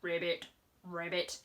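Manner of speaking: a low frog croak; frog noise. Shown as a demonstration. Presenter croaking for the frog in the story. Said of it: "look who's helping her to swim".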